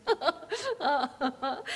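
slow, drawn-out laugh through the word. Acted. Widow introducing her young fiancé to her guests, laughing. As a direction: melt into a giddy laugh.

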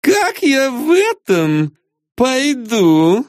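high, slow complaining woman; high whiny diva. A narrator's reading. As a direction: complain like a pampered star. Lara shows her heels.

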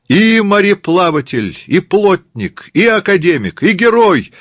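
very high, loud chanting; crowd roar. Acted. Katyusha's gang answers her, roaring in chant. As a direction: bellow the list like a rowdy chorus. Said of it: "ревут барбосы".

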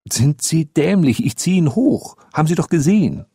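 put-on high-pitched, scornful boyish retort; exasperated boy. Boy explaining how he hauls the dog up, exasperated. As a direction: answer as if the question were idiotic.

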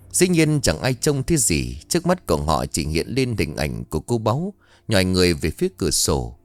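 ordinary delivery

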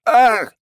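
sharp high scream of pain; pained cry. Acted. Convulsion hits counsellor.

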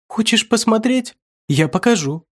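high, lisping lure; eerie high voice. Acted. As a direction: coax him in, eerily high. Lisping creature in the hall.

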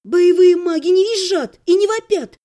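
pointed remark. A narrator's reading. Narrator scolds the sister. (назидательно, повышенным голосом)

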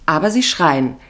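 ordinary talk

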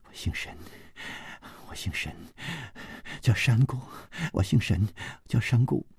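faint, dazed mumble; soft, broken, repetitive muttering; murmuring. A narrator's reading. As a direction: murmur it weakly, as if in a trance. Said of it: "她的口中不住喃喃地说".

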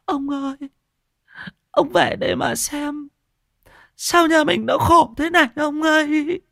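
high, wailing widow's lament. A narrator's reading. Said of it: "mà than". (than khóc ai oán, giọng cao vút)

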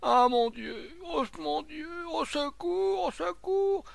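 very high, drawn-out wail of panic; acted hysterical cry for rescue; frantic wail. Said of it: "la bouche convulsée".

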